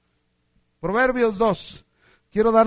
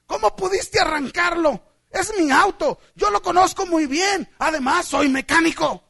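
high, loud protest in disbelief; indignant, amazed shouting; astonished mechanic; 7 semitones higher, 7 dB louder than usual. Acted. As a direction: demand an explanation, acting stunned. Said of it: "el mecánico se quedó sorprendido".

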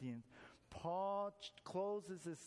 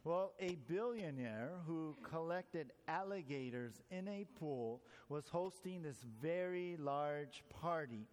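ordinary delivery

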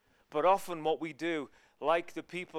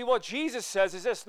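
usual speaking voice

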